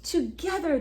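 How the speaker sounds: plain reading voice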